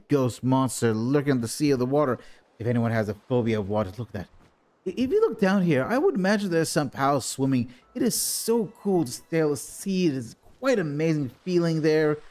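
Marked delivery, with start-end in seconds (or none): 4.8-12.2 excited. Acted. Gazing at the sea excitedly.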